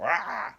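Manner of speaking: sudden high, loud scare yell. Retold or imitated. Scare actor pops out at maze guests, sudden yell. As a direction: burst out with a raspy-free "rah".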